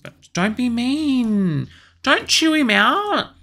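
high, slow pleading warning. Real, not acted. Pleading with sim.

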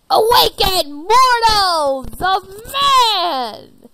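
slow, loud, high proclamation; booming awakening. Acted. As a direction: summon the mortals. Creature awakens.

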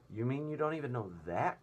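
mocking centipede. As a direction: tease him like a cheeky bug. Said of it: "cried the centipede".

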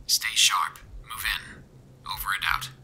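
soft, muffled radio command; military radio voice. A narrator's reading. Soldier orders his unit to move, regimented radio voice. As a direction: sign off tersely over a faint radio. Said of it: "tone of the man's voice as military".

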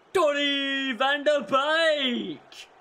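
loud, high, drawn-out name call; excited. Real, not acted. Gamer hailing his goalscorer, slow and elated. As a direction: stretch the scorer's name out in delight.

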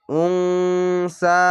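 slow chanted উংসা with held nasal; Quranic recitation. Shown as a demonstration. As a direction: recite উংসা drawn out, stopping at the end. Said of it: "জিম আছে, জিমের জায়গায় থামা ভালো".

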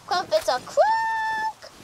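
1 shouted game line. Acted. Game NPC yells crumpets.

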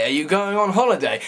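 ordinary delivery

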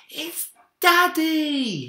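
put-on very slow, high, loud child's cry; joyful shout. A narrator's reading. Minik spots his father on the sled, high and loud. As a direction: shout it with joy. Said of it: "he shouted".

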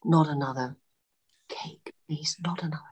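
whispered, low, weary begging; exhausted plea. Retold or imitated. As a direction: barely get the words out, as if worn out. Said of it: "was really tired".

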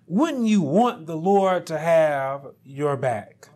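ordinary talk, nothing marked

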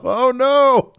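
high, loud scared yelp of oh no; loud high frightened oh no; mock terrified. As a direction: squeal it like a sudden fright. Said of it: "being terrified".

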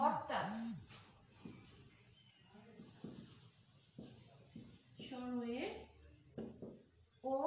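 stretched words with very long pauses; slow dictation. Shown as a demonstration. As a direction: dictate piece by piece as you write. Teacher dictates while writing it out.

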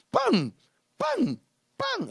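high shouted pam for each hit; blow sounds. Retold or imitated. Imita los golpes en el suelo. (golpes imitados a gritos)

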